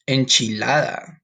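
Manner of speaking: "Enchilada" said slowly with Spanish vowels; "Enchilada" sounded out slowly the Spanish way; Spanish pronunciation. Shown as a demonstration. Teacher models "Enchilada" slowly for the class. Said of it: "That's how you say it in Spanish".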